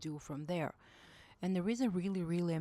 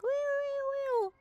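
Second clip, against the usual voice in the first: high, loud squealing wee; shrill mock goose cries; imitating geese; 5 dB louder, slower than usual. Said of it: "like the Canadian geese fly by".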